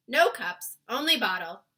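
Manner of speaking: loud blunt giant. A narrator's reading. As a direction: giant answers simply. BFG giant voice.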